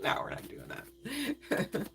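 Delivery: slow, high whisper, nearly voiceless; whispered refusal. Pretend. Reader refusing to name the outcome, slow whisper. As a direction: hush it like a naughty secret.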